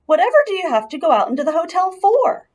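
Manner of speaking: high, loud, fast incredulous uncle; Uncle Lester asking. A narrator's reading. Uncle questioning Ralph, fast and high. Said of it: "asked Uncle Lester".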